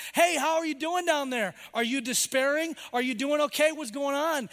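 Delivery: the world calling down to someone in a valley, loud and high. Acted. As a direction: shout it down into the valley. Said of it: "They're shouting down".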